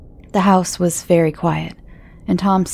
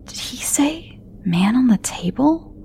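slow, stunned whisper; 6 semitones higher than usual. Acted. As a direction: breathe it out in disbelief. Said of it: "Tom whispered to himself".

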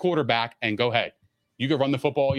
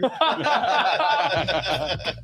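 laughing; 6 semitones higher than usual. Real, not acted. Joking after host's quip.

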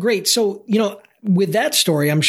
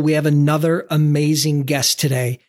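ordinary talk

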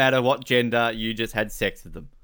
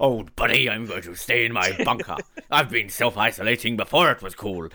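pirate voice; 4 semitones lower than usual. Retold or imitated. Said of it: "Sounds like a pirate".

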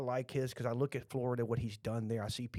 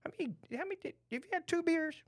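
higher-pitched mock interrogation; catching him out; faster than usual. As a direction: quote a suspicious check-up, pitched up. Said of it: "you could catch me like".

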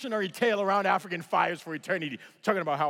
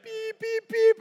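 very high, monotone electronic beeping; imitated alarm beeps; 5 dB louder, slower than usual. Imitating the plane's signal before the jump, high beeps. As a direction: mimic a cockpit altitude alert.

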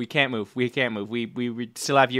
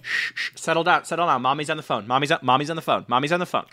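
put-on raised, firm shushing of children; hushing kids; 5 semitones higher, 4 dB louder, faster than usual. Mom hushing her kids while on the phone, firm and raised. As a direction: settle the kids down mid-call.

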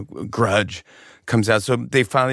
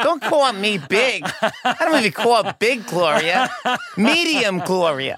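imitating mom; 11 semitones higher than usual. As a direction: play mom, protesting through a laugh. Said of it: "my mom would go".

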